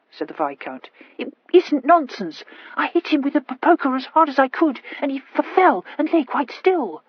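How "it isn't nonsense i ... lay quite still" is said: high, stammering insistence; a scared girl stammering out a confession; stammering young lady. A narrator's reading.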